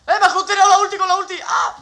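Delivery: loud high cry of disbelief; indignant gamer yell; outraged shout. Real, not acted. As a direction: yell at the unfair kill. Killed by an enemy ultimate.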